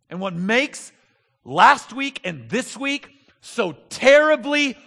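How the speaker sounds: loud, rising preacher's call, hammering each you've got; full-force preaching. Acted. Preacher urging the church to dig in, at full force. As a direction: drive the sermon home at full voice.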